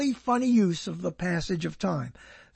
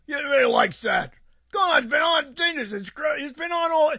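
mocking fan insistence; 6 semitones higher than usual. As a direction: blurt it like a pushy fan. Mocking fans who defend shows.

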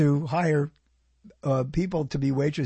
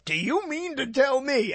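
higher, theatrical thirties film line; old-movie incredulity; 8 semitones higher than usual. Retold or imitated. Comedian quoting old movies, mock-dramatic incredulity. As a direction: imitate an old-movie actor's disbelief. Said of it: "that extra bit of something to say".

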